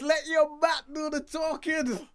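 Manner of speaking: emphatic call. Acted. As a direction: shout the motto to the team. Repeating slogan again.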